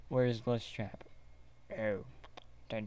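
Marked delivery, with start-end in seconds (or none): none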